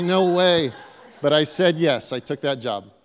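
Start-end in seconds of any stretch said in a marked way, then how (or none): 0.0-0.7 mock refusal. Refusing the principal job again.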